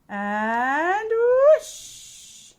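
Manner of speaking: high, loud swooping whoosh. A narrator's reading. Narrator voicing the broom's takeoff, loud whoosh. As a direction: sweep the word up like takeoff.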